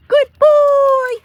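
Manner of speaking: put-on very high and loud sing-song good boy; high praise tone. Shown as a demonstration. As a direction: lift your voice way up to praise the dog. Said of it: "And we use the tone".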